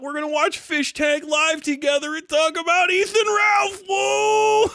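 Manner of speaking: high mocking whoop, shouted; hammed-up cheering parody; mock excitement. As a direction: hype it up like a sarcastic fanboy. Mocking forum users' excitement.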